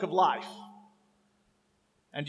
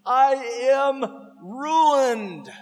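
drawn-out slow woe; slow mournful cry; slow lament; 4 semitones higher, much slower than usual. Acted. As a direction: stretch each word out in despair. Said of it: "Look what he says".

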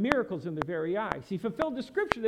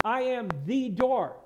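"I am the door" slow and drawn out; weight each word of "I am the door"; very emphatic; 3 semitones higher, 4 dB louder, slower than usual. Retold or imitated. Jesus tying the questions together, very emphatic. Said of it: "tying it together very emphatically".

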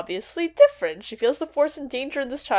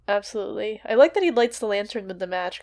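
ordinary talk

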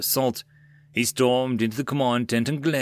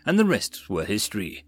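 ordinary delivery

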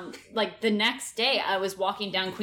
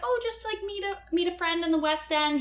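high nervous voice; 10 semitones higher than usual. Retold or imitated. Retold own nervous reply.

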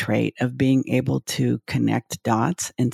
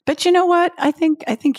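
excited; 4 dB louder than usual. Real, not acted. Eager interjection.

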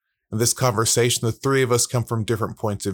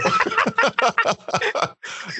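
burst of laughter. Real, not acted. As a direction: crack up at the joke. Laughing at own joke.

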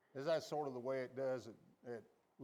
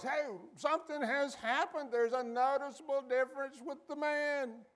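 excited crowd; 10 semitones higher, 3 dB louder than usual. Retold or imitated.

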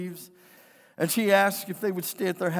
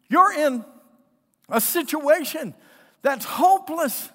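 retold disbelief, pitched high and drawn out; slow, high, emphatic protest; incredulous outburst; 7 semitones higher, 6 dB louder than usual. Preacher retelling his shocked reply to hopeful orphans, incredulous.

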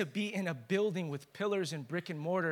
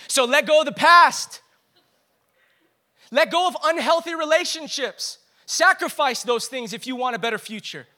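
louder, higher, urging; full-force preaching; 6 semitones higher, 11 dB louder than usual. Real, not acted. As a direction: call the congregation to let go.

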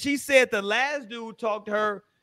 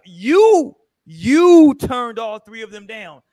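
"you" punched very loud and drawn out; slow, loud accusation leaning hard on "you"; emphatic stress; 9 dB louder, slower than usual. Real, not acted. Host arguing at the single woman, emphatic stress on "you".

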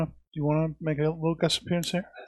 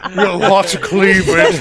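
louder, leering voice; mock leering guy; 5 semitones higher, 12 dB louder than usual. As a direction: ham up a lecherous guy. Mocking Dave's wish for cleavage.

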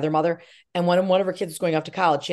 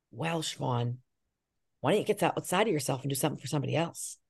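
much softer, measured voice; quiet motherly advice; 4 semitones lower, 8 dB quieter than usual. Retold or imitated. Mother advising her discouraged daughter to help others, quiet. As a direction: gently voice a mother's counsel.

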